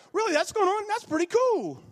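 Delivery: lightly voiced curious stranger. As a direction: play the friendly new acquaintance. Acting out a chat with a stranger.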